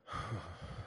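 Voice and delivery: soft, low acted sigh; imitated sigh. Poet imitates student's reaction to the line, acted sigh. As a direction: breathe out a quiet mock sigh. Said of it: "makes that sighing noise".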